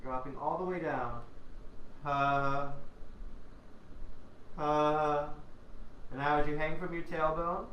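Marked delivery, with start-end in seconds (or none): 2.0-5.3 voiced double "ha" on the out-breath; breath turned to sound. Shown as a demonstration. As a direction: release two relaxed warm-up pulses of sound. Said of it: "to turn to sound".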